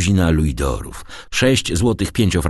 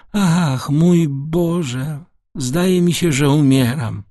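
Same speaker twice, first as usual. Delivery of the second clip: put-on high-pitched old man, overwhelmed; frail old father, overcome; 7 semitones higher than usual. Old father to his returning son, overcome with emotion.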